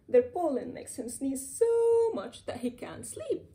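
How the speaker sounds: usual speaking voice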